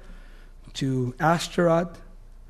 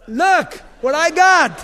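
slow, high and loud proud boast; 9 semitones higher, 8 dB louder, slower than usual. Acted. David showing off the giant's head, slow loud crowing. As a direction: hold up the prize and crow over it, drawn out.

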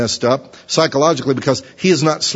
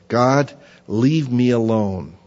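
slow weary plea; much slower than usual. Acted. Preacher voicing Job's plea.

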